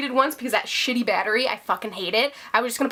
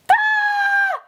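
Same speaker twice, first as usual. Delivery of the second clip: sudden high yelp of joy; excited shriek; 4 dB louder than usual. Real, not acted. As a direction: let out a quick thrilled squeal. Excited shout at video end.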